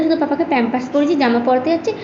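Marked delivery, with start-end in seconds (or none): none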